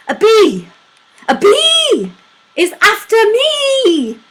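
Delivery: high, loud, breathless bee-poem recital; frantic poem reading. Acted. Reading a silly bee poem to Rose, high and frantic. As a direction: squeal it out as if a bee is chasing you.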